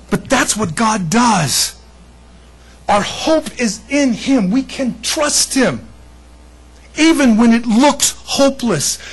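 rising preacher's proclamation; preaching. Acted. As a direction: lift it up and declare it slowly. Preaching with conviction.

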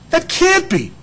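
high, startled disbelief; can't believe it's that easy; incredulous disbelief. Pretend.

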